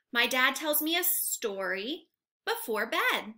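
ordinary talk, nothing marked

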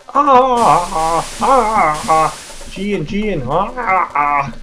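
loud, hammed-up jungle call; Tarzan yell. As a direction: beat your chest and yodel the call. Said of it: "That's basically what Tarzan does".

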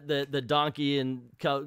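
ordinary talk

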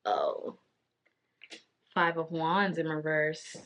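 ordinary talk

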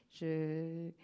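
normal talking voice

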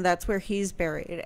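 ordinary talk

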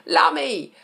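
high, loud delighted Lummy; savouring a favourite line; gleeful exclamation. Shown as a demonstration. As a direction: shout Lummy with glee. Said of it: "best line of any song ever".

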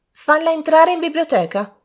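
high, loud imperious lady; interrupting. A narrator's reading. Daughter interrupting her mother, imperious high order. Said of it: "interruppe l'angelo".

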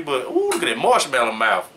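ordinary talk

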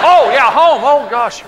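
high, dim-witted hunter suddenly remembering home; sudden realization. Pretend. Hunter in the pasture remembering his wife, surprised. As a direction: let it dawn on him loudly.

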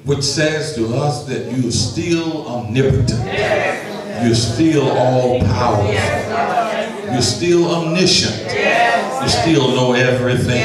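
steady, measured public prayer; earnest prayer. Acted. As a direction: pray aloud before the congregation, deliberate and sincere.

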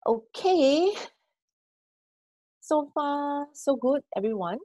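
breathless and winded, pitch high; catching breath between words. Real, not acted. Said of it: "I'm running out of breath".

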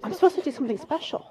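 blurting it out through tears; emotional plea. Retold or imitated. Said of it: "'cause I was just so emotional and crying".

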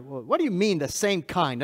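ordinary talk, nothing marked

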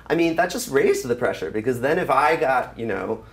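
ordinary talk

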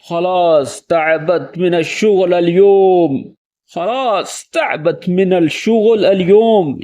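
slow, weary, worn out; tired sigh. Shown as a demonstration. Home from work, slumped on the sofa, exhausted. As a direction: drag it out after a long workday. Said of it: "تھکے ہوئے انداز میں".

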